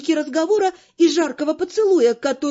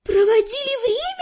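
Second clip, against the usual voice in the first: put-on high, outraged girl's voice; indignant exclamation; 8 semitones higher than usual. A narrator's reading. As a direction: throw it back indignantly, high. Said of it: "возмущённо воскликнула магичка". (возмущённо, высоким девичьим голосом)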